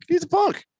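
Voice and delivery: high, comic protest at being questioned; high-pitched insistence. Real, not acted. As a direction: voice jumps way up, exasperated. Insists when pressed for reasons.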